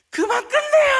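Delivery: loud, very high laughing yell; kids shouting. Retold or imitated. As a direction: holler it from the back like a worn-out kid. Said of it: "애들이 소리쳐요".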